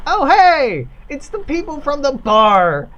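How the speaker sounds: loud, high surprised call; surprised greeting. Acted. Worker spotting the bar people, loud surprised greeting. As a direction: call out gladly to old acquaintances. Worker spots familiar faces.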